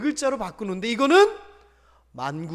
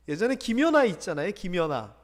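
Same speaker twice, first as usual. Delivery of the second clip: usual speaking voice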